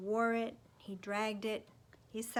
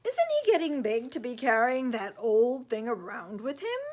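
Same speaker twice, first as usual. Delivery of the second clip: loud, higher disapproving neighbor; nosy neighbor; 3 semitones higher, 11 dB louder than usual. Acted. Neighbor lecturing Owen's parents, loud and scolding. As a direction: project it like a busybody scolding.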